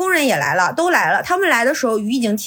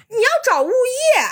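much higher-pitched mimicry of a know-it-all; imitated bossy instruction; 10 semitones higher than usual. Downstairs man instructing the flooded tenant, imitated as bossy. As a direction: mimic him telling her off.

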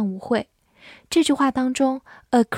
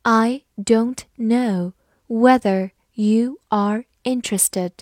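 slow, deliberate sentence reading; slower than usual. Shown as a demonstration. Teacher slowly reading the example to students. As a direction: spell out each word slowly for learners. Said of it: "好，我们慢慢来读".

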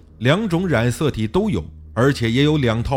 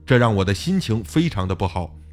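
usual speaking voice

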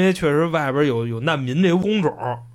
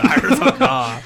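laughing; 9 semitones higher, 4 dB louder than usual. Real, not acted. Teasing, laughing question.

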